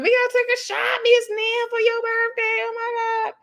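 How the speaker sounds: high-pitched mock party squeal; excited imitation. Tisha urging a birthday shot, high-pitched excited imitation.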